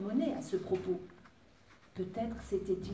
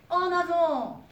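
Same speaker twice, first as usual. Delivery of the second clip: high, loud charging shout; howled battle cry; 10 semitones higher, 9 dB louder, slower than usual. A narrator's reading. Wolf sets off with fairy on his back, yelling. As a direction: yell it out to set off at a run. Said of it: "hurla le grand loup".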